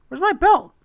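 squeaky, loud question; high-pitched alarm. Real, not acted. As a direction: shoot way up high, demanding the missing belt.

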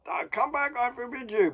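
high-pitched mock command; strained high call, hammed-up authority; calling after runaways. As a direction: imitate a teacher yelling after fleeing boys. Teacher calling after fleeing boys.

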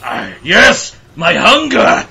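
high, loud hammy vampire; hungry vampire. Pretend. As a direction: growl out a monster's hunger. Voicing Dracula feeding.

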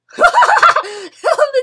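loud, high burst of laughter; big laugh. Real, not acted. Laughing hard as the door hits a player. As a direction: cracks up at the door gag.

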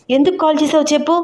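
annoyed wife cutting to the point; curt and serious. Acted. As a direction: snap the question out fast and cold. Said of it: "ఎందుకే అంత సీరియస్గా మాట్లాడుతున్నావు".

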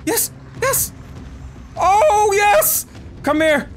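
thrilled shouts to a beloved animal; overjoyed. Real, not acted. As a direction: cheer the returning pet over. Overjoyed at the badger's return.

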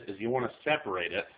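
usual speaking voice

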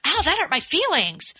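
a little yelp of hurt feelings; lightly voiced hurt reaction; hurt inner voice. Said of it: "your initial thought is".

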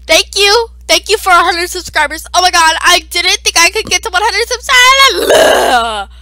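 high, loud, gushing rush of thanks; building to an excited shriek; over-excited thanks. Acted. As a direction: squeal your thanks at the top of your voice. Thanking for hundred subscribers, exaggerated excitement.

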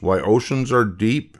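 ordinary delivery

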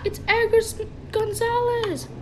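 high, exclaimed name reveal; excited reveal. Pretend. Host reveals who was unmasked.

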